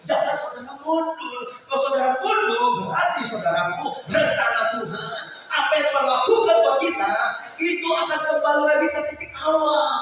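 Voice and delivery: high, loud, urgent exhortation; fervent pulpit warning; impassioned preaching. Real, not acted. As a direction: preach it at full force, stretching the key phrases. Preaches a warning at full force.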